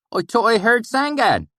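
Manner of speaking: put-on high, loud valley accent, wary; thick valley accent, still suspicious. A narrator's reading. Swineherd questioning strangers, suspicious in thick valley accent. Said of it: "he said suspiciously".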